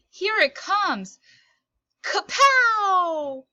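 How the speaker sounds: put-on high, loud, hyped-up kid yelling the pitch; excited pitcher. Kyle hyping up his big pitch, loud and excited. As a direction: wind up and blast out the kapow.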